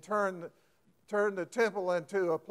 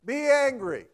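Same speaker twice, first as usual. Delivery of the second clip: permissive; 6 semitones higher, 6 dB louder than usual. Shown as a demonstration.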